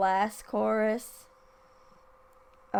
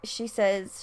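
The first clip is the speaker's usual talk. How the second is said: ordinary talk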